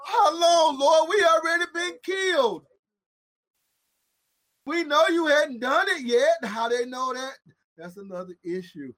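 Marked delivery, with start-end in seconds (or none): 0.1-6.4 anguished crying plea. Acted. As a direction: cry out how long, near tears. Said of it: "the souls under the altar said".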